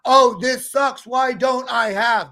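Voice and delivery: a loud "this sucks" moan; mock whining. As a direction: play the sulking victim.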